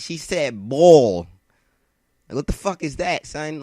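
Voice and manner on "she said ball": "ball" drawn out long and loud; exaggerated accented "ball". Calling out the classmate's accent to friends, "ball" loud and drawn out. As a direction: stretch "ball" loudly, mimicking her New York vowel. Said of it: "act like she ain't say it like that".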